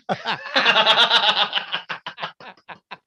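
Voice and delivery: high giggling laugh; real high laughter. Real, not acted. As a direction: laugh hard at your own joke. Laughing at own impression.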